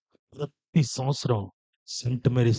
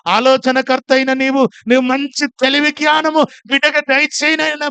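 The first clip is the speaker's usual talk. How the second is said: fervent prayer; 10 semitones higher, 10 dB louder than usual. Retold or imitated. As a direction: pray from a murmur up to a full shout. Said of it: "ప్రార్థన చేశాను".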